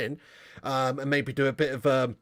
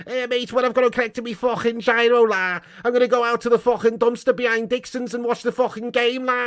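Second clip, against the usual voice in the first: high, exaggerated Scouse voice; hammed-up mock Scouse patter; Scouse accent; 6 semitones higher, 4 dB louder than usual. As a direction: do a cartoon Liverpudlian, pitched up. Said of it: "It should be this".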